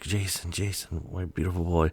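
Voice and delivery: high, soft cry to a lost son; tender mother. Retold or imitated. Voices mother finding lost son.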